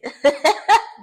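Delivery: loud, high burst of laughter; sudden squealing laugh; real laugh. Real, not acted. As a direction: crack up out loud. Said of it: "And I died laughing".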